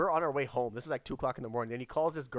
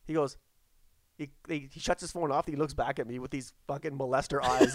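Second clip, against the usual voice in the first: ordinary talk, nothing marked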